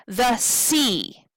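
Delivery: light "The", long falling "sea"; stress on SEA. Shown as a demonstration. Teacher confirming the stressed syllable, long "sea". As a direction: lengthen "sea" and let it fall.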